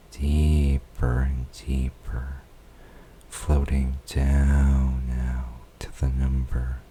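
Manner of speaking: normal talking voice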